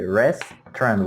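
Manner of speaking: restaurant said as REST-rant, middle vowel dropped; restaurant in two syllables. Shown as a demonstration. Teacher modelling restaurant as REST-rant, two syllables. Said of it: "We don't pronounce these vowels in the middle".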